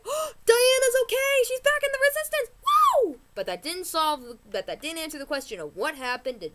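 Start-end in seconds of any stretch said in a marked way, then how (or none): 0.1-3.2 much higher, louder, fast fangirl cheer; a fan cheering at the TV, high and loud; excited squeal. Retold or imitated. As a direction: squeal with joy like watching the show. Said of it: "And I was like".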